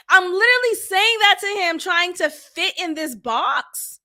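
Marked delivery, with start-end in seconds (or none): none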